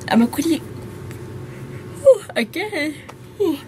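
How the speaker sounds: mid-yawn, breaking off. Real, not acted. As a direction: yawn through the words. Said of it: "yawning, like right now".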